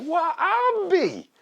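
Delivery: very high, long-drawn astonishment; mock amazement. Old neighbor seeing the chickens, drawn-out mock amazement. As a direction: stretch it way out in high-pitched wonder.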